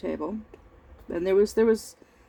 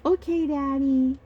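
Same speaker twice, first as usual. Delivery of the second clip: put-on singsong kid answering dad, pitched up; obedient child; 6 semitones higher than usual. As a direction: imitate yourself as a dutiful kid. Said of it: "And I'd be like".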